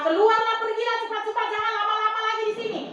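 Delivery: perintah Firaun yang tinggi dan mendesak, makin cepat di akhir; Firaun mengusir. Acted. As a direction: usir mereka seperti raja yang tak sabar. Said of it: "Sehingga Firaun berkata". (Firaun mengusir bangsa Israel dengan perintah lantang dan tergesa)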